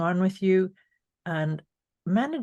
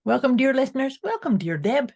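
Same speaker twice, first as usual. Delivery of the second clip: formal singsong phone greeting; telephone voice; 3 semitones higher, 4 dB louder than usual. Pretend. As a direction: answer like a polite telephone operator. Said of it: "this telephone voice".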